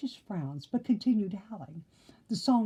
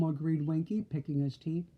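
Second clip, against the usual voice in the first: ordinary delivery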